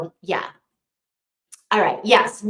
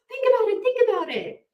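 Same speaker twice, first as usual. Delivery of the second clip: excited urging; 13 semitones higher, faster than usual. Real, not acted. Straining to recall a title.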